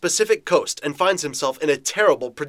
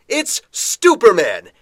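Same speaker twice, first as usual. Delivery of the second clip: grand announcer; 4 semitones higher, 8 dB louder, slower than usual. Acted.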